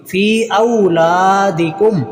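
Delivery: फ़ी औलादिकुम slowly, fee and laa drawn out; lengthened fa. Shown as a demonstration. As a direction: hold the long vowels in फ़ी औलादिकुम. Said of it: "लाम को लंबा करेंगे". (फ़ी औलादिकुम में फ़ी, ला लंबा)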